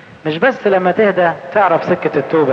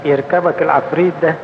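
ordinary talk, nothing marked

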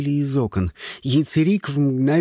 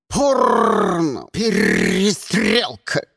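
loud slow squawk; squawking crow; 4 semitones higher, 4 dB louder, much slower than usual. A narrator's reading. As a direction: caw like a crow. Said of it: "заорал он".

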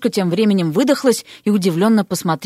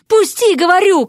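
louder, higher-pitched repeated demand; indignant insistence; 9 semitones higher, 5 dB louder than usual. A narrator's reading. Vasya, ignored inside the hat, insists loudly, indignant. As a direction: insist angrily on being released. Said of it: "Шапка негодовала".

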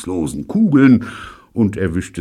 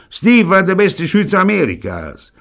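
Pirolo's voice; 8 dB louder than usual. Acted. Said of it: "kommentierte Pirolo".

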